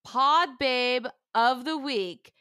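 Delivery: slow, drawn-out big announcement; award announcer. Pretend. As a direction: build suspense before the reveal.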